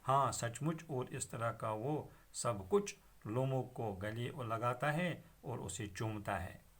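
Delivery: ordinary talk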